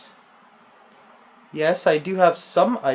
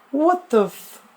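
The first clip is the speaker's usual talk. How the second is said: much higher, laughing disbelief; incredulous; 10 semitones higher, 4 dB louder than usual. Real, not acted. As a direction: unable to believe it. Laughing disbelief after scare.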